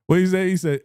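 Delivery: usual speaking voice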